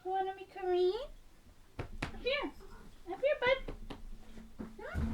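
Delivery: very high, soft coo to a pet; tiny high-pitched pet talk; pet-coaxing voice. Real, not acted. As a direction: coax the animal over, high and softly.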